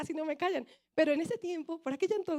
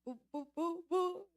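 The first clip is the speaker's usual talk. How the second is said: stammering bu bu; 6 dB quieter, slower than usual. Retold or imitated. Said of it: "Y yo, em".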